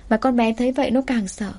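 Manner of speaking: ordinary delivery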